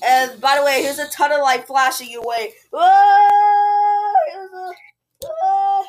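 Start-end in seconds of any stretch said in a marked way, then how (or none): 2.7-4.1 high, loud sustained "whoa"; long whoa. Pretend. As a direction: hold the cry high and loud. Reacts to flashing lights.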